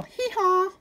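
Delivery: high braying hee-haw; donkey bray. Acted. Reader brays for the donkey child.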